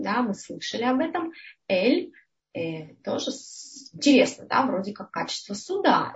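ordinary talk, nothing marked

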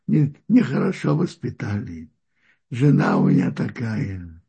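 ordinary talk

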